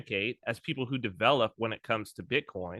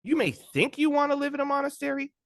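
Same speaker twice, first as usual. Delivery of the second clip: pitched-up lecturing voice; mock monk's blunt advice; 11 semitones higher than usual. Imagined monk warning a would-be novice, pitched-up lecture. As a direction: talk down like a stern old monk. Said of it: "He's not gonna be like".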